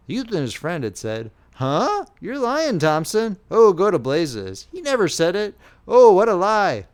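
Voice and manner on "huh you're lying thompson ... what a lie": mock scoffing. A narrator's reading. Embarrassed scoffing at praise.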